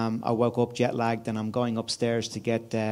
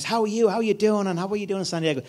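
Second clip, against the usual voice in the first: higher, over-friendly American hello; cheery American; 8 semitones higher than usual. Retold or imitated. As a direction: mimic a chipper early-morning American businessman. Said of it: "he was full of it".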